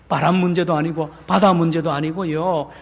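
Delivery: ordinary delivery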